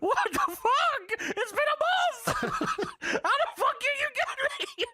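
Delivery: put-on high, strained old-man squeak; hammed-up high Paul Bearer whine; squeaky Paul Bearer voice. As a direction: squeal it like a pathetic old ghoul. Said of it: "Paul Bearer says".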